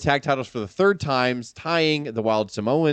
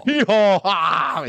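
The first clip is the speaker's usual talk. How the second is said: high, loud cowboy yeehaw; fake cowboy accent; 9 semitones higher, 4 dB louder, slower than usual. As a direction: whoop it like a mock cowboy. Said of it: "He goes".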